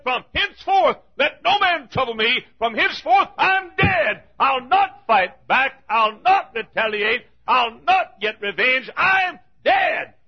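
shouted high, full-force sermon cry; fiery preaching. Acted. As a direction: proclaim it like a preacher at his peak. Paul quoted at full preaching force.

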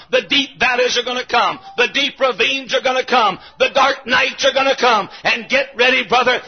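normal talking voice

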